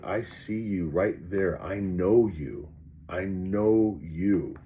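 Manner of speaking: slow, deliberate and steady; slow, knowing calm. Retold or imitated. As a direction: say it slowly, like you see through it. Calm recognition of the monster.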